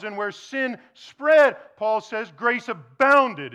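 slow, loud, stressed proclamation; slow emphatic preaching. Real, not acted. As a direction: lean hard on grace abounded. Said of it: "preaching emphasis".

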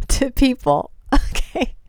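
slow, with laughter breaking in; amused laughing. Real, not acted. Retelling the bishops' question to listeners, amused laughing. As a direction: barely keep from laughing at the question. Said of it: "I'm laughing".

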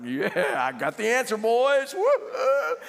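cocky crowing that climbs high and loud; hammed-up triumphant boast; strutting. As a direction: brag to the boys, ending in a whoop. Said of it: "he's strutting around".

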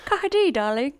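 hammed-up British society voice; mock posh British. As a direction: play at a posh British accent. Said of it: "really posh accent".

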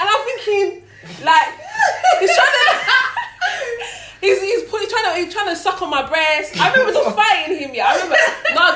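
high, loud laughter breaking through; can't stop laughing telling it. Real, not acted. Recounting the attack to friends, laughing helplessly.

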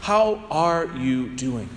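mean every word of "How are you doing?", slowly; sincere concern. Shown as a demonstration. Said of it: "No, no, no, no".

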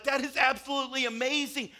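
excited master giving praise; delighted master. Retold or imitated. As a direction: burst with delight at the servant. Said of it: "the master's blown away".

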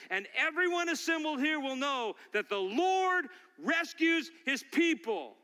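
high, ringing declaration building in force; forceful preached proclamation. Real, not acted. David declaring the Lord's victory to Goliath, forcefully preached. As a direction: proclaim victory to the whole assembly.

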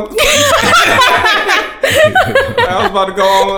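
high, loud retold indignation through laughter; helpless laughing mock outrage; laughing outburst. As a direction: crack up while acting offended. Retells his offended reaction, laughing.

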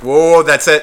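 loud, acted-out halt; emphatic refusal. Man backing away from bar drama, loudly calling it off. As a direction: call a loud stop to it all.

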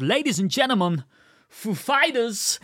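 imitated actor introducing a band, high; high announcer impression, drawn-out second word; slow, high stress on "Fighters"; Christopher Walken. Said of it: "emphasis on the second one".

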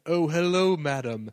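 loud, lofty mock-Elizabethan greeting; heightened Elizabethan. Co-host mimics the film character's entrance, heightened and loud. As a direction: greet a lady with overblown old-world courtesy. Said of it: "heightened sort of Elizabethan way of talking".

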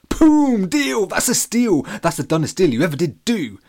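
high, loud jubilant shouts; boy crowing over a bargain; excited. A narrator's reading. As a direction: celebrate the deal like a win. Alex jumps at the deal.

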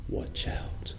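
hushed, breathy warning whisper. Shown as a demonstration. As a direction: whisper it like a secret alarm. Warning about dropping the watch.

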